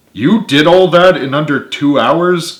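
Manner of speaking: incredulous, stretching the words; disbelief. A narrator's reading. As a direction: ask it in drawn-out disbelief. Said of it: "Carly asked in disbelief".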